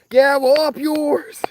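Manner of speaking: high, slow, hammed-up old lady sneer; sing-song mock insult in a grandma voice; grandma's mocking comeback. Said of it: "But she's doing it like this".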